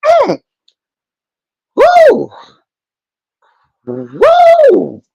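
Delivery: high falsetto woos; real high woo. Real, not acted. High exclaims, overcome.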